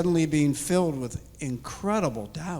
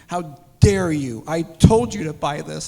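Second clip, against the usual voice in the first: loud, stern rebuke; rebuke from God; 3 semitones higher, 7 dB louder than usual. Retold or imitated. God scolding a doubting man, a loud stern rebuke. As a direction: thunder it down as God. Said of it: "his Spirit was just on me going".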